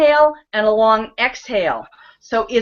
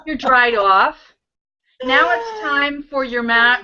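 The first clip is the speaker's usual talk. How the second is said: normal talking voice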